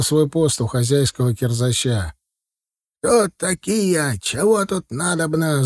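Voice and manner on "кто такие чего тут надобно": slow, higher-pitched gruff challenge; lightly growled elderly interrogation; growling old man. A narrator's reading. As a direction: demand answers like a cranky watchman. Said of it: "зарычал мужик".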